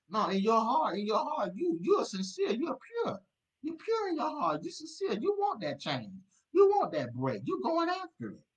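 high impassioned exhortation; soaring preacher cry. Real, not acted. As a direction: lift the pitch way up and plead.

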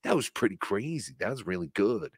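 very soft mock amazement; hushed, lightly voiced awe of a co-host; Joe Matarese. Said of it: "Joe Matarese would be talking like".

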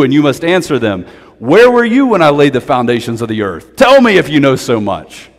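loud, commanding voice of God; booming divine rebuke; God thundering. Acted. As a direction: thunder from the whirlwind. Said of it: "the Lord answered Job from the whirlwind".

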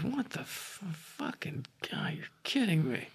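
whispered disbelief; giant's stunned aside. Acted. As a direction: breathe it out in a high, stunned hush. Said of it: "As he goes".

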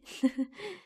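quick amused laugh; light laugh. Acted. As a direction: giggle at your own cheeky joke. Laughs at own joke.

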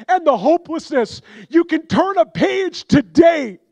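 high, loud, fervent preacher's cry; full-force preaching. Acted. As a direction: preach it out with everything you have, building to the altar call.

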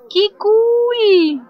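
high, loud, drawn-out cheer; delighted squeal. Real, not acted. Gamer watches the aliens interact, squealing in delight.

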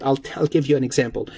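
ordinary delivery

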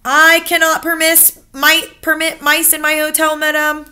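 high, loud, stammering manager; stuffy hotel manager. Acted. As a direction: fuss over the word like an offended manager. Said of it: "he said to my grandmother".

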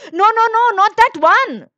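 high, loud, alarmed shopkeeper; urgent warning. A narrator's reading. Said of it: "warned the shopkeeper".

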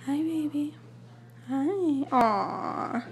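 high cooing voice to a pet. Real, not acted. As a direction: coo sweetly like talking to a kitten. Cooing to the cat.

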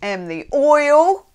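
put-on slow, drawn-out jokey Australian accent; playful fake Australian accent; mock Australian accent. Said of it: "everybody's half Australian today".

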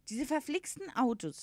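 high-pitched character complaint; grumbling horse. A narrator's reading. Horse blaming cars for its ruin, grumbling.

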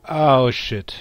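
loud startled oh shit; shocked. Real, not acted. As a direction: jolt of real shock. Aliens suddenly spotted.

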